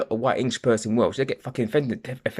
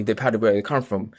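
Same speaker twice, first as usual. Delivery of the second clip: usual speaking voice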